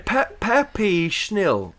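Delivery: Peppy Schnill drawn out slowly, each sound set down carefully. Shown as a demonstration. Careful slow repeat of the word.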